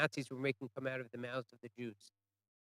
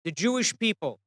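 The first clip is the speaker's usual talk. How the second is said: higher, louder defiant cry; mock scream, raised voice; retold scream; 6 semitones higher, 7 dB louder than usual. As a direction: cry it out from within a crowd. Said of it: "there's a scream".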